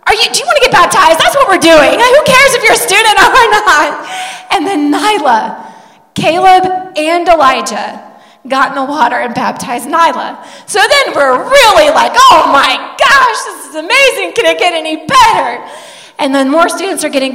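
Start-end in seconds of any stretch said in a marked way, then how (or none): 0.1-4.1 high, loud, fast imitation with a laugh; insistent urging. Girlfriend urging hesitant Nyla to get baptized, insistent. Friend brushing off daughter's objection. 12.1-15.6 amazed exclamation. Retold or imitated. Parents watching their kids get baptized, thrilled.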